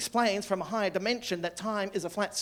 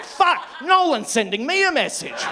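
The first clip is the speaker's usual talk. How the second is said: higher and louder, laughing; thrilled, laughing realisation; excited realisation; 7 semitones higher, 7 dB louder than usual. Retold or imitated. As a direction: blurt it out in giddy awe.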